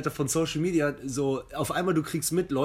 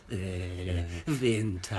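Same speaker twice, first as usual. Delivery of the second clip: grumpy; 9 semitones lower, 6 dB quieter, much slower than usual. Retold or imitated. Grumpy reply about winter.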